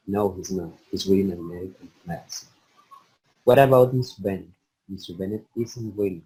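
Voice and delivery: careful, measured reading of a textbook passage; reading aloud. Acted. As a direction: read the lesson text through steadily, word by word. Student reads practice text aloud. (lectura en voz alta, pausada y cuidadosa)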